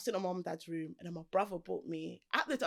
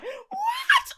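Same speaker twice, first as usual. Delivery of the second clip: much higher, loud laughing shriek of "What?"; mimicking mum's outburst; 14 dB louder than usual. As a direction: shriek like a furious mum flipping a chair. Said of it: "My mum went ballistic".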